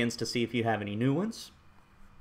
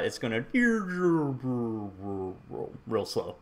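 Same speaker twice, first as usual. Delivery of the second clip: tape stop impression; 3 dB quieter than usual. Shown as a demonstration. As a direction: voice slowing and dropping like tape. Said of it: "That's my best tape stop impression".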